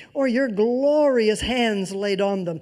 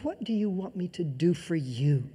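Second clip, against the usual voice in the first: tender Jesus; 4 semitones lower, 7 dB quieter than usual. Acted. Imagined Jesus speaking to listener.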